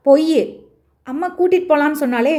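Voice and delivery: put-on a boy crying foul at his father; child protesting. (தந்தையிடம் குழந்தை உரத்த எதிர்ப்பு)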